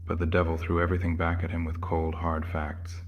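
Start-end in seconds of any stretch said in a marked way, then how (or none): none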